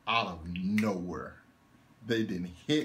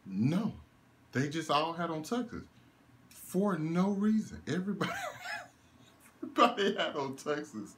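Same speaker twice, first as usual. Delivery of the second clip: laughing disbelief; 8 semitones higher than usual. Real, not acted. Laughing at absurd tux scene.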